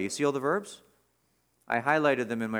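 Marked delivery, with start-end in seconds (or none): none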